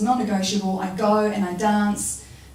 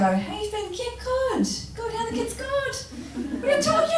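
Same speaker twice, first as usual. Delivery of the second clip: very high, bright small talk; fake cheerful. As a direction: pretend everything is fine. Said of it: "easy for us to go".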